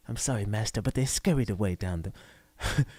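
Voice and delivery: low, soft, cringing creature voice; Gollum. Acted. Commentator mimics the witness as a Lord of the Rings creature, Gollum impression. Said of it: "was Gollum".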